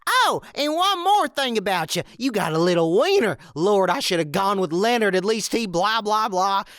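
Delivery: high, jeering insult rant; ranting wife. Retold or imitated. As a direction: spit it out mockingly like a nagging wife. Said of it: "bullcrap avalanche".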